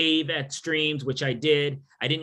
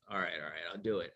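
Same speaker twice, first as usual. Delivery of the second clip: reluctant; 9 semitones lower, 11 dB quieter, faster than usual. Retold or imitated. Reluctantly accepting the name.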